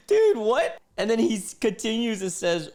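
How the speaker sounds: high, loud laughing outburst; laughing disbelief. Real, not acted. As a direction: crack up in disbelief while talking. Cracking up at the text.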